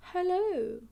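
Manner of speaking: high, loud cheery hello; Miss Rabbit greeting. Acted. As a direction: greet the customers brightly. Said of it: "says Miss Rabbit".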